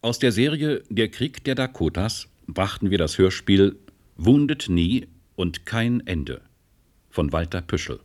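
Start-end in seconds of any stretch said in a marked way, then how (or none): none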